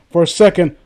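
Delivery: usual speaking voice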